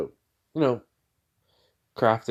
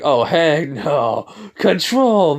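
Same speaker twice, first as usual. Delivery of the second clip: mock outburst; 6 semitones higher, 6 dB louder, much slower than usual. Shadow reacting in retelling.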